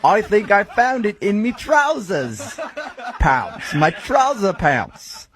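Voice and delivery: mock British accent. American caller mocking British slang, slow British accent. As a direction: laugh through a cheesy British voice. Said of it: "fascinated with my accent".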